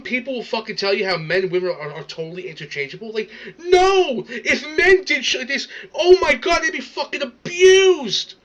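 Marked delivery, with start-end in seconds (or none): 3.6-8.3 high, loud indignant outburst; indignant rant. Real, not acted. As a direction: protest at a raised pitch, outraged. Ranting about a double standard.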